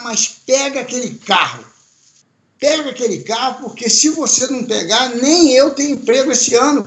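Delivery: mild mock boss urging him on; urging on the phone. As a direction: push him hard to go back. Gary urging on phone, retold.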